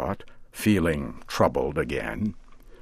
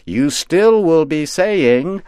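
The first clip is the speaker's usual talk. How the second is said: put-on higher, slow, teasing old witch; mocking witch; 8 semitones higher, 4 dB louder than usual. A narrator's reading. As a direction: lecture him with sly mockery. Witch lecturing the scarecrow.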